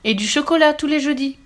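put-on high, loud child's clincher; insistent. A narrator's reading. Boy adds a reason to save the tree, insistent. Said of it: "insista-t-il".

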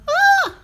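very high piercing shriek; bird's shriek of horror. A narrator's reading. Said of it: "poussa un cri d'horreur". (cri d'horreur très aigu)

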